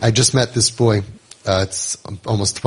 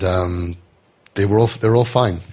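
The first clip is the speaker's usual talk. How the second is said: usual speaking voice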